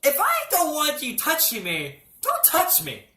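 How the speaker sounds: high, insistent mock outrage; indignant protest. Patient objects to a male doctor touching him, indignant protest. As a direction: squawk it in high-pitched protest.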